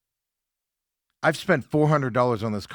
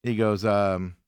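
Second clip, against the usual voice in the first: ordinary talk, nothing marked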